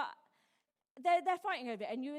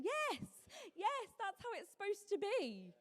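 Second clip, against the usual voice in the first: high-pitched, joyful yes; delighted approval; 8 semitones higher, slower than usual. Acted. God seeing family love well, delighted approval. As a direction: cheer them on from on high, pitched way up.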